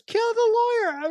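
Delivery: very high-pitched imitated war cry; rally the gang with a high, playful yell; hammed-up call to attack; mock battle cry. Repeats Rufio's battle cry.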